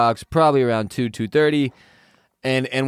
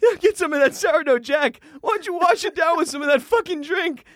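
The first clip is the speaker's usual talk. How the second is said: high, squeaky mimicking of the friends, much higher; mocking friends; 14 semitones higher than usual. Storyteller mimicking friends pushing him to eat, high squeaky voice. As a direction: parrot them in a silly high voice. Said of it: "Roz, you".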